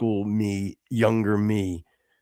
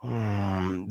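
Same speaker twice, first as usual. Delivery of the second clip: normal talking voice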